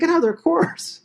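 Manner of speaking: very high mock disbelief; incredulous wife. Wife hears husband bought a course, mock incredulity. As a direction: shoot the pitch way up in imitated dismay.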